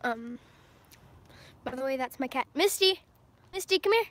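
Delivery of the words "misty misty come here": calling the cat, higher and louder. Real, not acted. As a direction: call loudly across the room.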